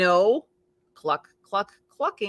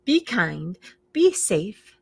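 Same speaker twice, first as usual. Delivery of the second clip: plain reading voice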